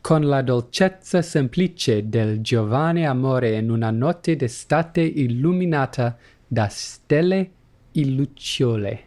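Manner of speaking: reading the Italian with an Italian accent; careful Italian accent, lightly done. Shown as a demonstration. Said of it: "Italian accent is not as good as yours".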